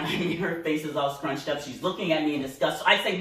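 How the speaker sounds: usual speaking voice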